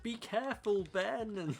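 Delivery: cartoon character voice. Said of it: "out of context clip where he's like".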